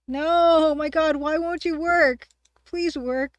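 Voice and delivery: loud exasperated begging; pleading with a machine. Real, not acted. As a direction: beg the game to work, loud and high. Game not working, begging.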